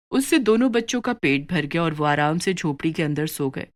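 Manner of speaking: plain reading voice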